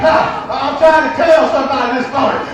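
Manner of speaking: ordinary talk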